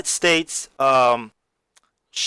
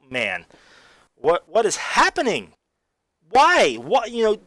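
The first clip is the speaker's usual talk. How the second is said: bewildered; 6 semitones higher than usual. Retold or imitated. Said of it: "I was like".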